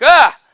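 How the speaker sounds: short high startled shriek, loud. Pretend. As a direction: squeal like she's been startled. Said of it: "And she's like".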